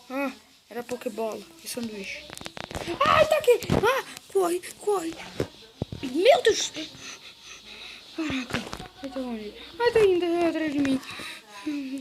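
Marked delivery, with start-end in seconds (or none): 3.1-6.7 being chased. Real, not acted. Monster chasing the player. 9.8-11.0 being chased. Real, not acted. Monster running after player.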